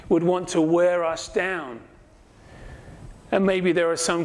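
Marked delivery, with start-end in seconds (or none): none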